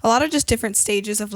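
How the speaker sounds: normal talking voice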